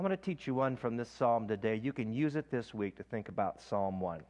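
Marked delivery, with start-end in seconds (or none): none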